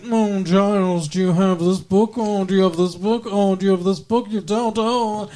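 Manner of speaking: a hint of a condescending British watcher; mock British lady. Mocking the British watcher talking down to Giles, British accent. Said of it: "she's very British".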